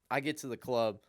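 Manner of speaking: ordinary delivery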